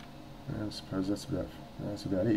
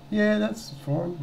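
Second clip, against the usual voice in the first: very high, drawn-out woman impression; slow, high-pitched mock policewoman; agreeable policewoman; 14 semitones higher, much slower than usual. Voices policewoman agreeing on phone.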